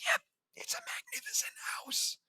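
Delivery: soft, breath-only whispered reply. A narrator's reading. JD answers Varee about the house, whispering. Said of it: "I whisper".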